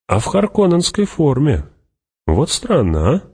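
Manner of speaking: Fremen warrior, puzzled. Acted.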